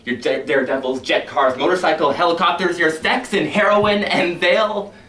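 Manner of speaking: a list that swells into a shout; building shout. Acted. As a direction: crescendo to a fever pitch. Crescendo list.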